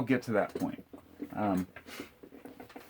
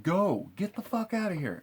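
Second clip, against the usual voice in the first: retold scream; 10 semitones higher than usual. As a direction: re-enact screaming him away at moderate volume. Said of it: "I just screamed".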